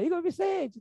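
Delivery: a mother teasing her son with his full name; playful mother calling. Retold or imitated. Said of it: "tem um tom que ela faz que é brincadeira". (mãe chamando Igor Vicente de brincadeira)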